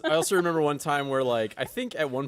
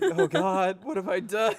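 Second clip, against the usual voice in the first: higher horrified voice; 7 semitones higher, 4 dB louder, slower than usual. Retold or imitated. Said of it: "I was just like".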